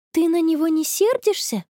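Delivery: high-pitched astonished question; amazed. A narrator's reading. Friend asks about the boy's secret, amazed. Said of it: "изумилась Конни".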